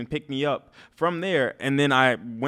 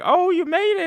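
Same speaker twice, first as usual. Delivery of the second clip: delighted greeting; 5 dB louder than usual. Retold or imitated. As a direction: imitate an excited, high-voiced welcome. Voices a woman's delighted greeting.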